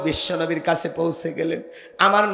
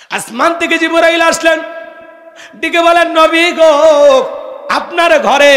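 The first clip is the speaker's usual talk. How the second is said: high, shouted sermon storytelling; full-force preaching; 10 semitones higher, 8 dB louder than usual. Acted. Preacher tells of Jibrail calling the Prophet, loud preaching. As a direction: proclaim it loudly at a raised pitch.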